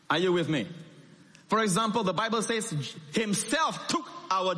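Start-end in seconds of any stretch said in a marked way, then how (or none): none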